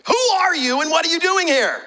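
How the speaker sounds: high, loud shouted demand; guard shouting. Retold or imitated. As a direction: yell it like a guard losing patience. Centurion asks a third time.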